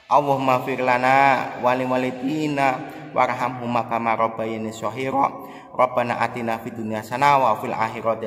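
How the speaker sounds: loud, chanted supplication; fervent prayer. Acted. Preacher leading viewers in prayer, loud and fervent. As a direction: call out to God with full fervour.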